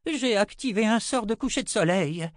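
high-pitched exasperated announcement; urgent old wizard. Acted. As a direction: blurt out the alarming news.

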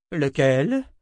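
put-on old woman's sharp question; a clipped schoolmistress query; Anna, stern. A narrator's reading. Anna asks Richard sharply. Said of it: "demanda Anna, les bras croisés".

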